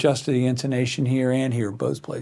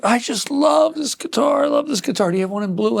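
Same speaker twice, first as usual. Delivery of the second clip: imitating a gushing customer, pitched way up; mock customer gushing; 9 semitones higher than usual. Customer gushing over a guitar, retold in a high mock voice. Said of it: "somebody said".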